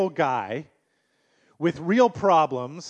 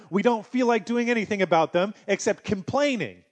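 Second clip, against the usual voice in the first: higher, louder preaching build; preaching at full force; 4 semitones higher, 3 dB louder than usual. Acted. Pastor urging his church to change attitude, forceful preaching. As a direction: drive the point at the congregation.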